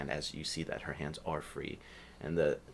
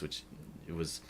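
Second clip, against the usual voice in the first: ordinary talk, nothing marked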